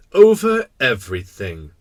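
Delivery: loud king, matter-of-fact; simple kingly certainty. Acted. King answering with great simplicity, certain. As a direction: state it loudly and simply as king. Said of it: "with great simplicity".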